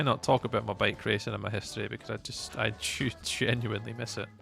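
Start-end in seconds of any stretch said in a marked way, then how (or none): none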